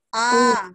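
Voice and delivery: A as a bare vowel, loud and held; silent initial consonant. Shown as a demonstration. Teacher modelling vowel-only reading, loud sustained A. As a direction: open straight into A. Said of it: "langsung ke huruf vokalnya".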